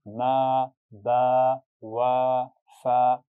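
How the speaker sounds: मा, बा, वा, फा each with the aa held long; drawn-out aa. Shown as a demonstration. Teacher demonstrates long aa to students: drawn out. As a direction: stretch every aa. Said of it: "लंबा करके खींच कर पढ़ेंगे".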